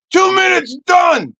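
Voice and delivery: high, loud triumphant shout; hammed-up quick finish; mock climax. Acted husband finishing quickly.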